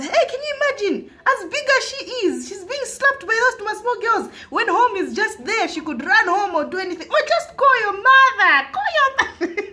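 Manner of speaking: high-pitched outraged auntie imitation; hammed-up scandalised neighbour; indignant neighbour. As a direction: exclaim in mock disbelief, building to a loud cry.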